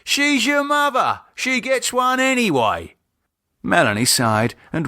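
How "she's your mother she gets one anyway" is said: dad insisting to daughter; insistent. Acted.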